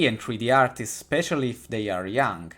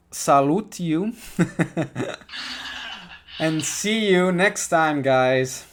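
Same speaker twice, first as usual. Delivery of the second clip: laughing goodbye; 5 semitones higher, 4 dB louder, slower than usual. Real, not acted.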